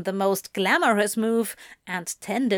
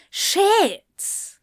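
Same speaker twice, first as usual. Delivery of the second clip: shit cried out high and loud, falling away; panicked cry; 11 semitones higher, 4 dB louder than usual. Acted. Crowley realising his mistake, crying out shit in panic. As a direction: let the panic burst out high.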